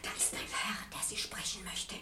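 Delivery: secretive hushed warning; landlady whispering to the student that a policeman waits. Acted. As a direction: whisper it so the police won't hear.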